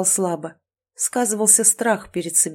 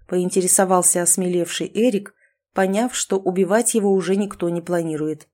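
ordinary delivery

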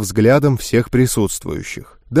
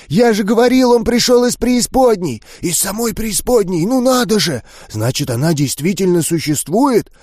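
higher, eager babbling voices; excited ghoul chatter; 8 semitones higher than usual. Acted. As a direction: buzz with thrilled disbelief. (взволнованный гомон гулей)

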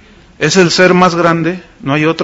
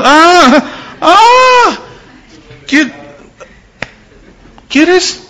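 God challenging Job; 4 semitones higher than usual. Retold or imitated. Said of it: "Como Job se quiso poner picudo".

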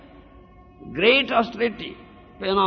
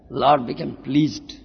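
ordinary talk, nothing marked

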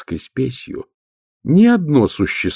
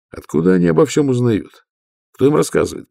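ordinary delivery

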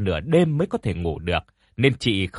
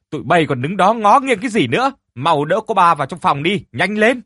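high, loud, commanding yell; loud urging shout; 7 semitones higher, 5 dB louder than usual. A narrator's reading. Mistress yells at servants to carry her daughter, high commanding shout. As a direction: bark orders at the frozen servants. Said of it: "la lớn thúc giục".

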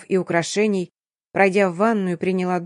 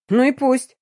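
high, dismissive retort; defiant; 5 semitones higher than usual. A narrator's reading. Heroine brushing off stepsister's warning, defiant.

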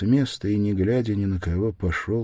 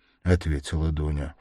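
ordinary delivery, nothing marked

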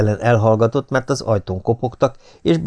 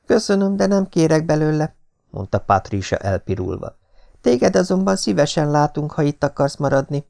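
put-on high young woman's voice, pitched much higher; Patricia's voice; 7 semitones higher than usual. A narrator's reading. Blushing Patricia answering the lord in a high voice. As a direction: decline lightly, then invite warmly.

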